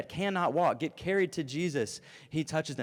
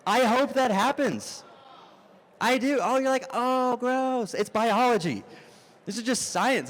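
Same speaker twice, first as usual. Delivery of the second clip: excited; 8 semitones higher, 5 dB louder than usual. Real, not acted. Preacher joking about congregants' babies.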